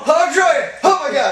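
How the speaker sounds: high, loud, flustered shouting; frantic excitement. Real, not acted. Player fighting to land the fish, frantic. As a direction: cry out as you struggle to reel in.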